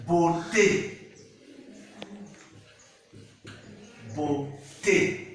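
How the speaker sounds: "beauté" in two separate syllables, bo-tay; final é demonstrated. Shown as a demonstration. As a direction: model "beauté" with a clear final "ay". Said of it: "We have the word like".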